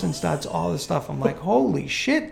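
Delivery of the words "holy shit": high-pitched, acted disbelief; retold shock. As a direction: replay being stunned, pitch jumping up. Stunned reaction to the threat.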